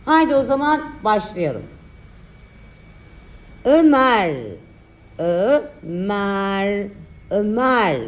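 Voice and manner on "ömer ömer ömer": slow, drawn-out dictation; dictating. Shown as a demonstration. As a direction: say each word clearly for children to write. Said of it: "Ö harfi sonuna kadar dikte çalışması".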